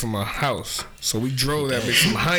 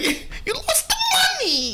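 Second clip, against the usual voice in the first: squeaky, very high mock shriek; hammed-up squeaky screech; Rosie Perez. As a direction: imitate a piercing, squeaky nagging woman. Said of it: "your fucking squeaky ass voice".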